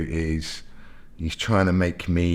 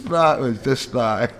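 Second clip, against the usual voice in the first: laughing; 4 semitones higher, slower than usual. Real, not acted.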